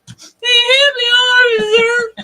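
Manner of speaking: high, loud, drawn-out wail; hammed-up shouted plea to an officer; mock cry for help. Joking to partner about being pulled over, mock wailing.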